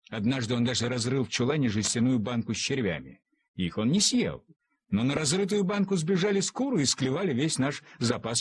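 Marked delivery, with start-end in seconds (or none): none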